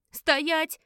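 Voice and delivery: sharp, high, loud command; рявкает. A narrator's reading. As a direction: bark it to stop someone dead. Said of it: "рявкнула Элис". (резко рявкает приказ)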